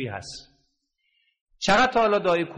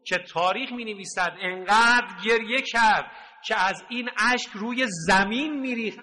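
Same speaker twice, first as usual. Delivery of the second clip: usual speaking voice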